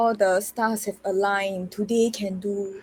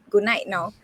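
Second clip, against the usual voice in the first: usual speaking voice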